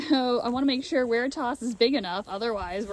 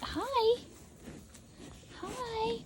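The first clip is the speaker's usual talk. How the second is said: cooing hello; 12 semitones higher, 7 dB quieter than usual. Real, not acted. Greeting the lamb in a high coo.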